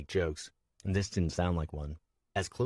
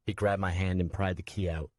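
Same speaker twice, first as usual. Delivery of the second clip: usual speaking voice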